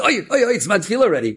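high, loud alarmed exclamation; startled oi oi oi. Acted. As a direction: jolt of surprise at the late hour. Kid realizes the time.

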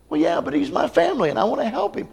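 high, quick, soft pleading for family; pleading Barnabas. Retold or imitated. Barnabas pleading with Paul, high and quick. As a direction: plead gently for your cousin.

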